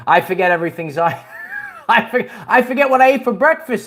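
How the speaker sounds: ordinary delivery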